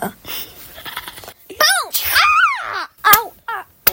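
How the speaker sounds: high yelps of pain; pained cries. Acted. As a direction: cry out as if hit. Hit, crying out in pain.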